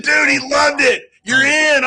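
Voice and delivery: a gleeful, hammed-up cheer; excited shout. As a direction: sell him on it with over-the-top glee. Hyping Vader, laughing.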